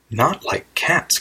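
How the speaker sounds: high, drawn-out indignant cry; shrill, passionate. A narrator's reading. Mouse to Alice about cats, shrill and passionate. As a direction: cry it out slowly in a high, offended voice. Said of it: "in a shrill, passionate voice".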